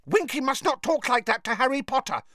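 high elf voice scolding firmly; a touch of elf indignation; angry. A narrator's reading. Dobby scolds Winky for rudeness to Harry, angry. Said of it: "said Dobby angrily".

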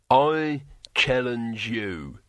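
slow, weighty challenge; blunt warrior voice; barbarian hero. A narrator's reading. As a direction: issue the challenge deliberately.

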